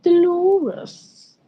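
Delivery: high-pitched, long swoop on Dolores; Dolores drawn out theatrically. Shown as a demonstration. Saying her name as always, Dolores stretched grandly. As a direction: croon Dolores high, then slide down. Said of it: "I'll always say her name like that".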